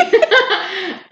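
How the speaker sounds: loud high burst of laughter; high giggle. Real, not acted. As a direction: break into a squealing laugh. Laugh after announcing Instagram.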